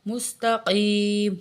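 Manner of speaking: مستقیم with a thick, clearly voiced qaf; very slow, heavy qaf. Shown as a demonstration. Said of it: "قاف موٹا والا ہے".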